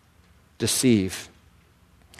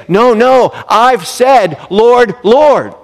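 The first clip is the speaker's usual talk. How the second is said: higher, louder insistent protest; protesting churchgoer; 8 semitones higher, 5 dB louder, slower than usual. Acted. Churchgoer waving off the Holy Spirit, protesting. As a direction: brush off the conviction, pleading. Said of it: "and you say".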